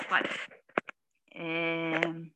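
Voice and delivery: usual speaking voice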